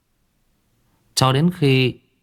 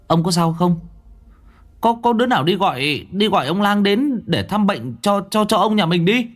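put-on higher, urgent young servant calling; worried servant; 9 semitones higher than usual. Servant boy Tý calling his fainted master in alarm. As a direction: shake your master awake, frightened.

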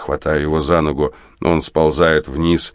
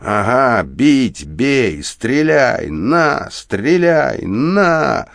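frenzied challenge; 5 semitones higher, much slower than usual. A narrator's reading. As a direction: pitch climbing with each dare. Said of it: "он хрипит и наступает на меня". (исступлённый вызов с нарастанием)

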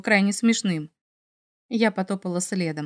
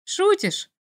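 much higher mocking disbelief; amused scoff; 10 semitones higher than usual. A narrator's reading. Dragon scoffing at the heroine's worry, amused. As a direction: scoff at her doubt. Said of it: "хмыкнул Мик".

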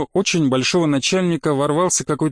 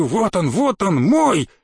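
drawn-out exultation rising high on the last word; elated, stretched shout of possession; triumphant cry; 3 semitones higher, slower than usual. Acted. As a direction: crow over the recovered treasure. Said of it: "Крикнул торжествующе".

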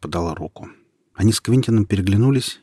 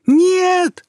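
very high, loud anguished cry; desperate scream; 9 dB louder than usual. A narrator's reading. Boy screams as Nika stabs herself, very high desperate scream. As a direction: scream as she falls. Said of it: "закричал мальчишка".

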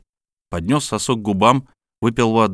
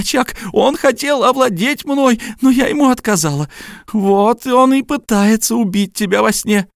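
hysterical woman; 14 semitones higher, 5 dB louder than usual. Acted. Helena pleads, accuses, urges.

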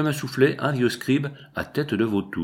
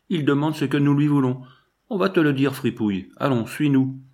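lightly voiced thug, higher pitched; voyou menaçant; 6 semitones higher, 3 dB louder than usual. A narrator's reading. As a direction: order the victim along, raised pitch. Bandits bousculent le narrateur. (voix de voyou qui commande, un peu plus aiguë)

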